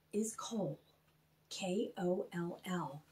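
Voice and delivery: soft whispered introduction; hushed whisper. Acted. Host introducing Koll to children, in a hushed whisper. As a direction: breathe his name like a secret.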